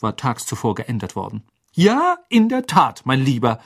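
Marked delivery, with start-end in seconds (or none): 1.7-3.6 trilling Fat Lady. Acted. Fat Lady greeting Harry, high trilling sing-song. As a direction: trill it out, pleased with herself. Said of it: "trällerte sie".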